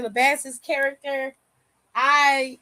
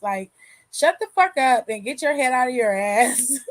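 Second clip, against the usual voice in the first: ordinary talk, nothing marked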